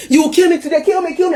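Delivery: high, loud cry of defiance. Retold or imitated. Beaten wife to her ex, crying out. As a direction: cry it out high in quick bursts. Acting herself while being flogged.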